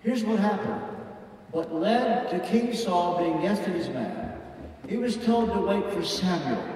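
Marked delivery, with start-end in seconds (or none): none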